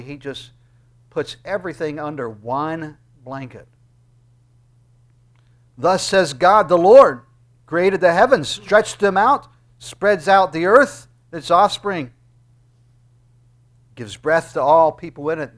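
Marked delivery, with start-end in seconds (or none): 5.8-15.6 proclamation. Acted. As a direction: declaim it like a herald of God. Proclaims God's words.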